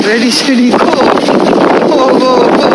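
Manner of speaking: loud call: ready, steady, go, high and loud; shouting. Real, not acted. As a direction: cheer them off with a loud shout. Calling a race start.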